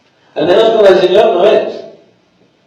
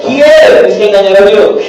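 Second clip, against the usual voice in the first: ordinary talk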